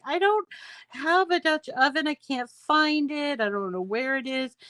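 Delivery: high-pitched retold sister; imitating sister. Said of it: "and she's like".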